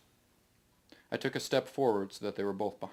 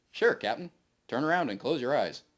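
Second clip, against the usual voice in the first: higher, louder cheerful computer voice; bright obliging machine, a notch higher and louder; AI voice Cab; 4 semitones higher, 6 dB louder than usual. Acted. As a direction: chirp it back like an eager ship AI. Ship AI Cab answers Greg.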